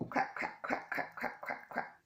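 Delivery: playful duck quacks. Pretend. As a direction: quack like a duck, dying away. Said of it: "Can you be a duck?".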